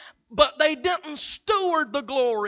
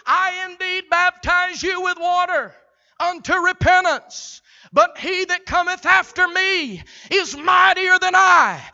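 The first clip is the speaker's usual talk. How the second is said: louder, higher, slow preached cry; fiery prophet proclamation; 5 semitones higher, 3 dB louder than usual. Acted. John the Baptist crying out to the crowds, booming. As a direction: thunder it like the prophet in the desert.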